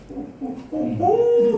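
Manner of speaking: hammed-up high sobbing sounds; imitated sobbing. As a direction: act out the child's sobbing. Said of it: "Pas saya peluk, dia nangis".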